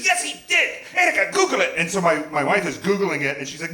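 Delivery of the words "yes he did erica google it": high, insistent mock yell; mock screaming. Mother-in-law arguing in the car, mock screaming. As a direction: imitate her insisting at a high pitch. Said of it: "all three of us are screaming".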